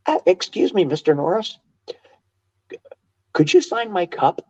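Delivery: high, pinched-nose squeaky fan voice. Retold or imitated. Prankster in high pinched voice asks the champion for an autograph. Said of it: "I pinch my nose, ... very high voice".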